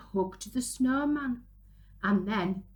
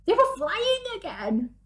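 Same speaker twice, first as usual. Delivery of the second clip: excited; 10 semitones higher, 5 dB louder than usual. A narrator's reading. Flying again.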